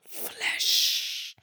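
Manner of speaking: whispered high, eerie "Flesh"; spooky whisper. Pretend. Podcaster echoing the recap line, mock-eerie whisper. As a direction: breathe out "Flesh" like a horror trailer. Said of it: "made flesh".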